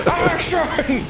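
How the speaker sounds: very high, strained comic shout; mock director's yell. Director shouting over a gas-powered camera, mock yell. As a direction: yell it over an imagined engine.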